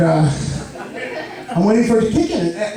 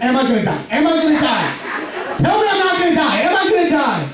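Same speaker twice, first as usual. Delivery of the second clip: higher, begging for reassurance; dying soldier pleading; 7 semitones higher than usual. Retold or imitated. Patient begging the paramedic, a hint of a dying soldier. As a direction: plead like a wounded soldier in a war. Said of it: "like a dying soldier in a war looking for reassurance".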